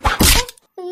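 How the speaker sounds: sudden high, loud cry of pain after a slap; startled cry. Acted. Daughter-in-law cries out to her mother-in-law, startled and loud. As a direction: yelp as the blow lands.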